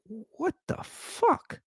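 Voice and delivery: very high, slow, stunned disbelief; baffled. Retold or imitated. Waking from a dream of mom nagging, stunned and baffled. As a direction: drag it out in total bafflement. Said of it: "I woke up and I was like".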